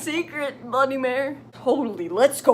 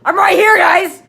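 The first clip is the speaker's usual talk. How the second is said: indignant villain; 6 semitones higher, 10 dB louder than usual. Acted. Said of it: "And Voldemort's just like".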